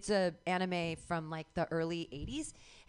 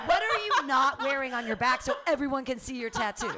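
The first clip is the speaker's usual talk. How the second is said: loud, high mock-accusing question; mock the old victim-blaming line; mock scolding; 4 semitones higher, 7 dB louder than usual. Teasing her friend, loudly mock blaming tattoo.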